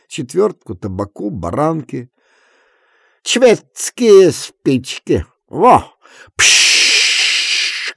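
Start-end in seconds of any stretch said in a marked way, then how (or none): 3.2-7.9 slow, high, loud bragging; drunken show-off. A narrator's reading. Kuzma shows Efrem matches, slow drunk brag.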